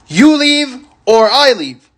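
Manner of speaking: slow, high-pitched mock shout; retold shouting. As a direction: imitate an angry coworker's drawn-out ultimatum. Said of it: "even though he was shouting".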